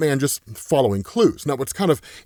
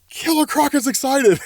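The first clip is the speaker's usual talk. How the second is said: much higher, bursting into a laugh; excited delight; 11 semitones higher than usual. Real, not acted. Reviewer telling viewers about Croc's toy, excited and laughing. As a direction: squeal it out with glee.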